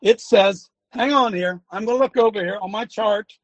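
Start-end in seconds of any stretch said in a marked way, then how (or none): none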